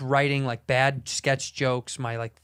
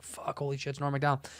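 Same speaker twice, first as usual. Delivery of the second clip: soft, starstruck under-the-breath aside; hushed awe; 8 dB quieter than usual. Retold or imitated. Starstruck young comic seeing Norm, hushed awe. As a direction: mutter it to yourself, starstruck.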